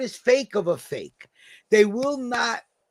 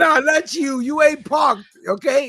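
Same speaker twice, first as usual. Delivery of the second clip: higher, raised voice; teasing protest; 6 semitones higher, 3 dB louder than usual. Real, not acted. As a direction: shut the claim down emphatically. Teasing protest about being parked.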